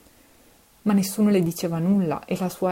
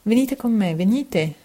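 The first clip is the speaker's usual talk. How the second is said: urgent call; 4 semitones higher, 5 dB louder than usual. A narrator's reading. Mother urges officer to come.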